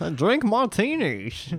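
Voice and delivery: high, slow Connery-style Bane voice; drawn-out movie villain impression; Sean Connery Bane. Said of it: "that was Bane if he was played by Sean Connery".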